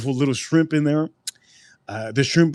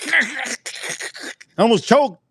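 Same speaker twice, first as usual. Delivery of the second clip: squeezed out much higher through a laugh, slowly; 10 semitones higher, much slower than usual. Real, not acted. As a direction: laugh it out, barely holding it together. Laughing while retelling choking.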